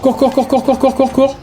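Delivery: high, rapid-fire panicked chant; frantic urging. Real, not acted. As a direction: urge them to run in a high squeal. Urging character to run away.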